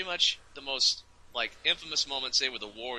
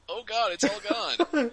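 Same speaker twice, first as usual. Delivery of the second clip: mock panic; 10 semitones higher, 4 dB louder, slower than usual. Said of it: "and then all of a sudden it's like".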